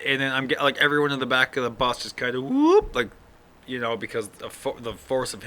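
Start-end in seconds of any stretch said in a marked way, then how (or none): 2.4-2.9 imitated lurch noise, high-pitched whoop; sudden mock whoop. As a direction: whoop like being thrown forward. Imitates passengers lurching forward.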